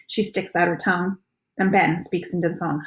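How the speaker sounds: plain reading voice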